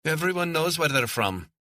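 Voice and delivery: put-on high, sulky child's voice; a defiant kid answering back; sullen boy. A narrator's reading.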